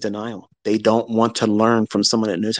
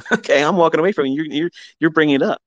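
mock huffy uncle; 6 semitones higher, faster than usual. Uncle walking away annoyed.

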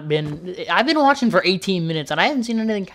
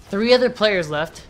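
normal talking voice

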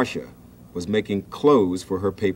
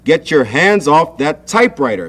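higher, louder sharp shout; father shouting; 5 semitones higher, 6 dB louder than usual. A narrator's reading. Father shouts at Sam off the typewriter. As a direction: bark at the boy to let go. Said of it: "shouted his father".

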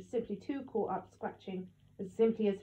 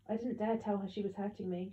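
ordinary delivery

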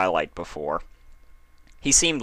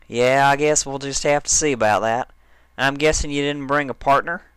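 high, loud bragging banter; cocky Dash; 7 semitones higher, 4 dB louder than usual. A narrator's reading. Dash ribbing Applejack about partners, cocky. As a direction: puff up and challenge her.

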